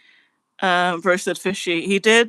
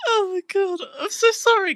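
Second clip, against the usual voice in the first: much higher voice, apologies squeezed out between laughs; laughing fit; 10 semitones higher than usual. Real, not acted. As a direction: try to apologise but keep cracking up. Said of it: "They've put me in a, like, laughing fit".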